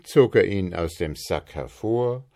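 ordinary delivery

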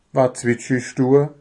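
ordinary delivery

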